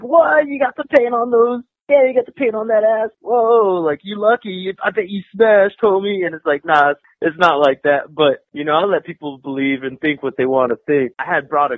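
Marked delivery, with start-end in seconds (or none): 0.0-6.2 high-pitched, incredulous hype; amazed onlookers. Retold or imitated. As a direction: mimic jealous buddies going nuts, pitched up. Imitating amazed commenters online.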